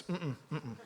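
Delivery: soft closed-mouth mm-mm, lips sealed; tight-lipped hum. Retold or imitated. Man answering God keeps his mouth shut, humming softly. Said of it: "He better not open his mouth".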